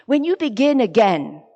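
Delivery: ordinary delivery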